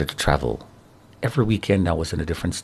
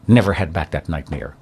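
ordinary talk

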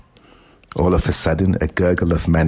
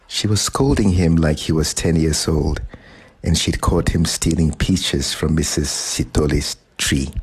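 plain reading voice

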